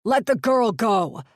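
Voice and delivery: loud, booming demand. Acted. Sykes roaring at the pirate to release the girl, booming. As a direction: boom it across the clearing. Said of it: "Sykes's voice boomed".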